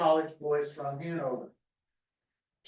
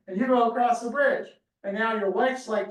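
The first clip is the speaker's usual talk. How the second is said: normal talking voice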